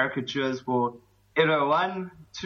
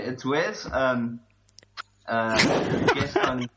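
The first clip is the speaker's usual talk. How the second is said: usual speaking voice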